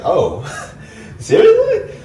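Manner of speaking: laughing squeak, jumping very high on seriously; incredulous laugh. Retold or imitated. Man reacting to girlfriend calling him broke, incredulous laughing squeak. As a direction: disbelief breaking into a laugh.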